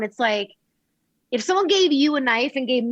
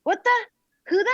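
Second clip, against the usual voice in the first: high-pitched, startled questions; high, comic voice for a puzzled pet; confused dog voice; 10 semitones higher than usual. Retold or imitated. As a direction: voice a baffled dog demanding answers. Voicing the confused, suspicious dog.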